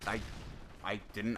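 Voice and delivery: normal talking voice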